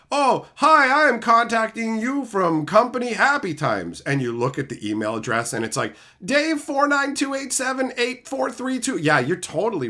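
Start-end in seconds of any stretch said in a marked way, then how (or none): 0.1-4.0 high, loud fake-cheery scammer; mocking scammer. Mocking scam emailer. 6.2-9.0 mocking scammer email. Reading scam email address.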